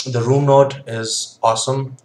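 talking around pipe stem; pipe in mouth. Real, not acted. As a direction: lips clenched on pipe. Seen 1: pipe stem clenched between lips while speaking throughout.

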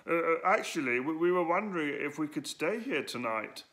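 timid request with faltering starts; a nervous boy asking a gruff bear to stay; quavering. Acted. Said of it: "quavered Edwo".

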